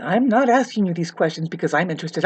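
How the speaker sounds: ordinary talk, nothing marked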